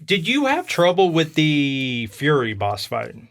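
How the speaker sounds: normal talking voice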